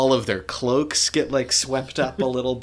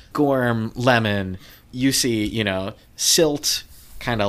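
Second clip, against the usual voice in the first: usual speaking voice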